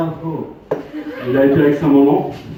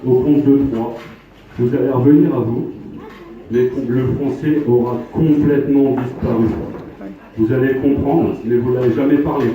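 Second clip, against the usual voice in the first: hypnotic induction; 3 semitones lower than usual. Acted. Stage hypnotist putting a volunteer under, low commanding voice. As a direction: lull the subject, then snap awake.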